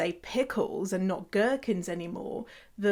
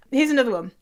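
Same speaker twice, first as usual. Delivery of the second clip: usual speaking voice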